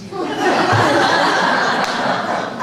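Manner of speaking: a short, amused laugh under the breath; breathy laugh. Real, not acted. Speaker laughs recalling the remark about accents, breathy laugh.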